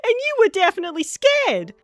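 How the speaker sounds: high, loud mocking jeer; loud, crowing tease; gleeful teasing. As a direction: rub it in, pitched right up. James teases Gordon after laughing.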